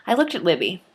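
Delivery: usual speaking voice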